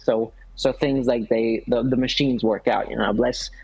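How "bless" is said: usual speaking voice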